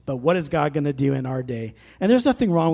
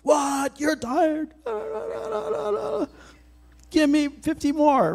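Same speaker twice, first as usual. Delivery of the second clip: much higher barking drill sergeant shout; 11 semitones higher, slower than usual. Retold or imitated. Drill sergeant yelling at a tired recruit, high barked shout. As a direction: bark it like a boot camp drill instructor. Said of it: "Get drill sergeant on yourself".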